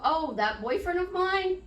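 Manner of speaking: put-on bright high "that boyfriend of mine"; lilting, cheerful and high. Shown as a demonstration. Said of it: "what would you say".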